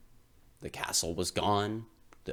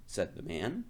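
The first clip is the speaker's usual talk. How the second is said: plain reading voice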